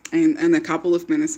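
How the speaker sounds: ordinary talk, nothing marked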